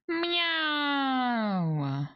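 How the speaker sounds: a hungry cat greeting the fish; eager cat meow. Pretend. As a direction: meow like a cat spotting dinner.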